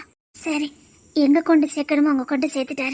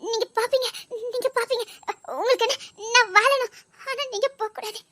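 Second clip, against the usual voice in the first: tearful pleading; 5 semitones higher, 5 dB quieter than usual. Acted. Wife pleading with departing husband.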